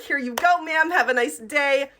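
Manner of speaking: put-on polite cashier smile; fake customer-service cheer. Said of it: "the fake little customer service smile".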